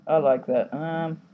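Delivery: ordinary talk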